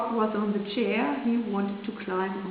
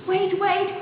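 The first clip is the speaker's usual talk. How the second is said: high, urgent cry after her; frog crying out; 9 semitones higher than usual. A narrator's reading. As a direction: call her back before she runs off. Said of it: "cried the frog".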